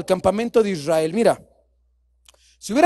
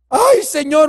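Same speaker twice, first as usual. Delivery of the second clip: usual speaking voice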